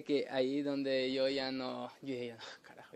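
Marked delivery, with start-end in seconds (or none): 2.3-3.0 whispered curse. Retold or imitated. Unvoiced muttered curse.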